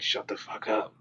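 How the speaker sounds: imitating a hushed, near-whispered telling-off; hushed scolding. First A D scolding on set.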